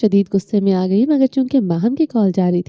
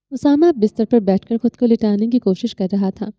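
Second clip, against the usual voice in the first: usual speaking voice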